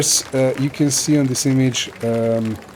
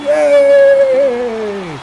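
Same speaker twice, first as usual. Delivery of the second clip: very high loud mock cheer; crowd cheer; 8 dB louder than usual. The crowd cheers the monk, a high shrieking yay. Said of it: "everybody's like".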